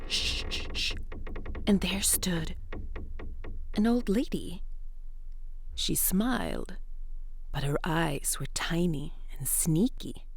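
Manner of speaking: ordinary delivery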